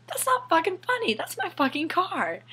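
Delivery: high, loud outrage; furious rant at the dad; indignant outburst. Real, not acted. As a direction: blow up over the car. Outraged at dad's joke.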